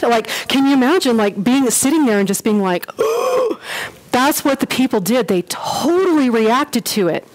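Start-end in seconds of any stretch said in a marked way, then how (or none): 2.9-3.9 high sharp intake of breath; imitated startled gasp; shocked gasp. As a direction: gasp as if hearing the unthinkable. Shocked congregation reacting.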